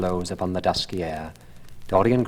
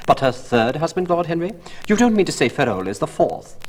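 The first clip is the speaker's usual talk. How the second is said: put-on higher, louder incredulous old hostess; surprised old lady; 9 semitones higher, 5 dB louder than usual. A narrator's reading. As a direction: gasp at the gossip like a nosy hostess. Old hostess asks Lord Henry.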